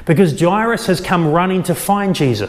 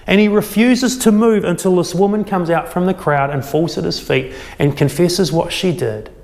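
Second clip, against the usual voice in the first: normal talking voice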